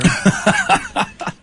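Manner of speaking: very high breathy squeal; squealing laugh. Real, not acted. Host squealing with laughter before the Godfather request.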